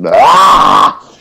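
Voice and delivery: hammed-up "aah"; mock-epic cry. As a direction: cry out in parody heroics. Climax of the mock-epic riffing.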